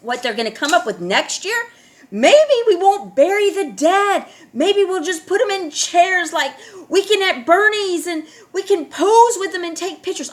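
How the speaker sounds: loud, high sarcastic rant; mock the idea, big and scornful; sarcastic mockery. Real, not acted. Ranting to viewers about the news, loud sarcastic mockery. Mocking what they'll do next.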